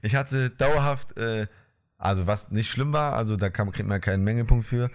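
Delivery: usual speaking voice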